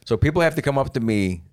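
ordinary talk

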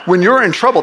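ordinary talk

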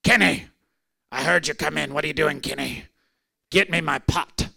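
lightly voiced old man asking for his pot; old patient calling his caretaker, lightly voiced; imitating the old man. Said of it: "that's exactly how he talked".

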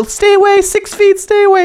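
high, loud mock warning; mock yelling to keep a kid six feet away; mock shout. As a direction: shoo someone off with a silly high yell. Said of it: "I hold up the stick and go".

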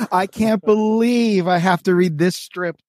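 high, drawn-out playful whine; mock exasperation. Mock complaint before reading strip aloud.

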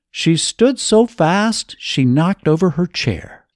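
ordinary delivery

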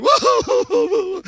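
high, loud laughing whoa; mock awed whoa. Imitating people amazed at a revival meeting, high laughing whoa. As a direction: squeal it like someone suddenly blown away. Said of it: "And people go".

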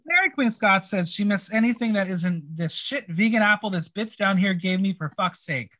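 ordinary talk, nothing marked

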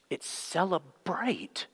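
slow, soft punchline; hushed revelation. Acted. Abbot tells Father John the true word, hushed.

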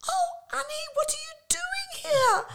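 very high, gushing squeal; shrieky mock delight; excited reunion. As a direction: imitate a friend thrilled to bump into you.

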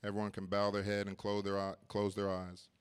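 pastor inviting the congregation forward, gently; gentle altar call. Acted. Altar call with heads bowed.

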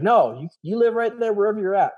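high, emphatic reassurance; excited. Retold or imitated. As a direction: brush off his worry, keen. Boss reassures him on phone.

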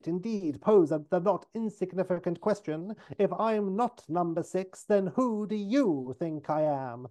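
high, theatrical talk through a tight smile; mock-polite, about to erupt. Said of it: "says through a tight smile at the redhead".

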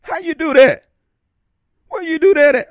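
high, loud, excited imitation of a rapper's catchphrase; Master P impression. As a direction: call it out high like Master P hyping a crowd. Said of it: "As Master P would say".

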